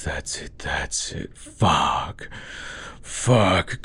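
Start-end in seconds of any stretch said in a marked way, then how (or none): 0.0-0.6 whispered urging. Acted.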